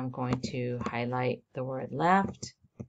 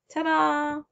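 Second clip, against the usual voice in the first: high, sung-out ta-da of a finished step; playful ta-da; 12 semitones higher, 4 dB louder than usual. Real, not acted. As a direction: show off the result with a high-pitched flourish. Showing off the finished highlights.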